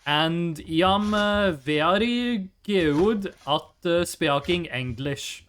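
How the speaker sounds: lightly voiced, halting Norwegian English accent; slow, careful Norwegian politician accent. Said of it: "talk like this".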